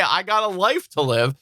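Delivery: ordinary talk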